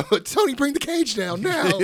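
high, slow mock demand; demanding wrestler. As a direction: call for the cage like a ring villain. Imitating wrestler demanding a cage.

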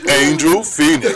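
low, slow mock announcer through laughter; game announcer parody. Reminding him of his name, mock Mortal Kombat announcer. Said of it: "you say your name like Mortal Kombat".

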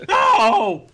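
high, loud yelp of protest; shouted no. Acted. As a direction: shriek the word No!. Jokey safe word, shouted.

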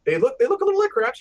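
high, fast and amused; bursting with amused agreement; excited. Real, not acted. Laughing agreement about crab-like aliens.

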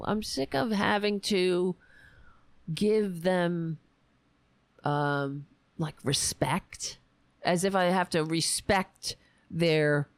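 normal talking voice

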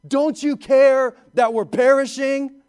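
loud, high, slowed plea to a sleeping Jesus; panicked cry. Retold or imitated. Frightened disciples wake Jesus in the storm, crying out loud and high. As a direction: cry out in terror over the storm. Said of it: "and they go".

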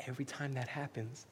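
ordinary delivery

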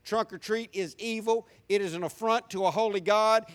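usual speaking voice

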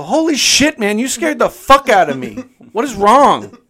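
high, loud startled yell; very high, loud shout of shock. Retold or imitated. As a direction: yell it as if you nearly fell to the floor.

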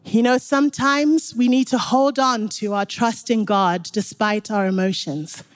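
usual speaking voice

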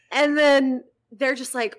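ordinary talk, nothing marked

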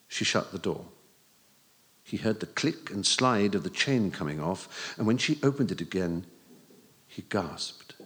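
usual speaking voice

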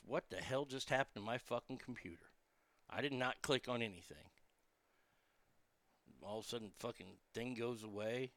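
annoyed low aside, almost to himself; muttering at the computer. Real, not acted. Computer glitch mid-show, muttering.

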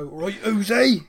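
loud, hammed-up country farmer accent. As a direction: put on a rural farmer's accent. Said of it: "In your farmer accent there".

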